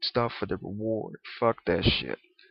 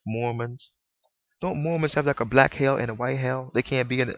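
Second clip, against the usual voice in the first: usual speaking voice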